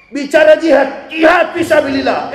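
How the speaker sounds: loud, high, fired-up preaching cry; fiery shout. Acted. Preacher mocking fiery jihad talk, loud fiery shout. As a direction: thunder it like a call to holy war. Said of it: "suara menggebu-gebu".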